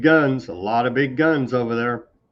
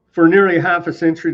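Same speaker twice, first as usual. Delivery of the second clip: mildly hammed-up, higher and louder, quick; mock propaganda read; 6 semitones higher, 7 dB louder, faster than usual. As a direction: read it out as overblown official propaganda.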